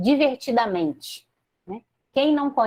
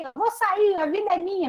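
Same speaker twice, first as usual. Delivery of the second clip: higher, defiant imitated protest; mock angry retort; 10 semitones higher than usual. Grandparent snapping back at grandchild, mock defiant higher voice. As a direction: play the stubborn old man refusing, half-hearted anger. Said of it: "Aí ele brigando com raiva".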